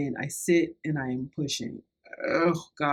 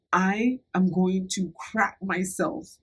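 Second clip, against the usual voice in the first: slow, drawn-out urgent warning; slower than usual. Retold or imitated. Telling her friend she is about to have an accident, slow and urgent. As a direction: spell it out slowly, each word weighted. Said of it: "I didn't say crap".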